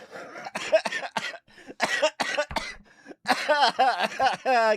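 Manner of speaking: loud, high burst of laughter; big laugh. Real, not acted. Laughing hard at Fishbones. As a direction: crack up at the joke.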